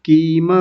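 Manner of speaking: कीम with a long ई, as the student said it; wrong long vowel. Shown as a demonstration. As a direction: stretch the i in कीम. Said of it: "किम को कीम यानी बड़ी ई".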